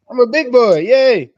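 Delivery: high, loud kiddie cheer; mock childlike glee. Co-host joking he can drink now, mock childlike glee. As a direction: boast like an overexcited little boy. Playing a proud big boy.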